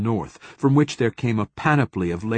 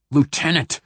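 sharp, higher cut-in; commanding interruption, voice raised; snapped; 6 semitones higher than usual. A narrator's reading. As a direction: snap the rank to silence him. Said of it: "snapped Theo in a tone".

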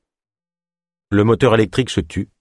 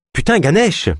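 high-pitched, exasperated outburst; impatient; 11 semitones higher than usual. A narrator's reading. Ava fed up with her colleague's evasions, impatient. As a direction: burst out with impatience. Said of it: "s'impatienta Ava".

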